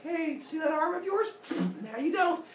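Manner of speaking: high teasing brother voice; high mocking sibling; taunting. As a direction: taunt him gleefully, up high.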